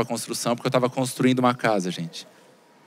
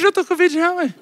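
high-pitched, insistent outburst; heated comeback; 14 semitones higher than usual. Acted. Son answering hospital worker back in argument, heated comeback. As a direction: top the other person, pitched right up high.

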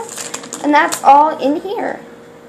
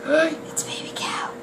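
softer, hushed whisper; awed whisper; 2 semitones higher, 10 dB quieter than usual. Real, not acted. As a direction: gasp then hushed whisper. Whispering over cute cow.

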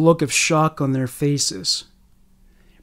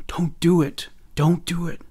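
soft, half-whispered plea; mouthed warning; 4 dB quieter than usual. A narrator's reading. Samantha silently warning Misty off, hushed and mouthed. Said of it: "mouthing the words".

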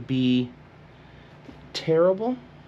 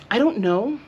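squeaky uncertain I don't know; high doubtful rise; 13 semitones higher, 5 dB louder than usual. Real, not acted. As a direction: lift it high, unsure. Doubt about bangs.

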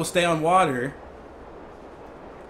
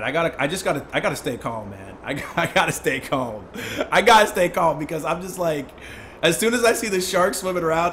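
ordinary talk

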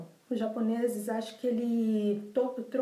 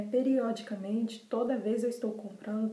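normal talking voice

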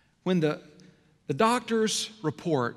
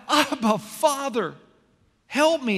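high, raised desperate plea; crying out for help; 8 semitones higher, slower than usual. Retold or imitated. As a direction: cry up to heaven for help. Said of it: "He cried out".

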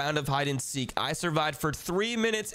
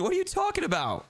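squeaky fake innocence; 12 semitones higher than usual. Lying to brother in trash.

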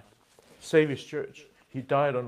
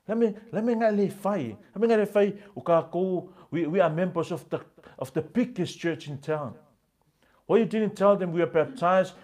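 raised, higher-pitched scolding, louder in bursts; furious husband; 5 semitones higher, 4 dB louder than usual. Retold or imitated. Husband scolding his wife for saying nothing. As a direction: scold her like an outraged husband. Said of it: "And the man was furious".

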